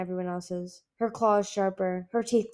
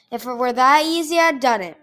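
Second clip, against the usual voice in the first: much louder snapped retort; 4 semitones higher, 9 dB louder than usual. A narrator's reading. Icicle snapping at her brother's naive plan, angry. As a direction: bite the words off. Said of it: "Icicle snapped".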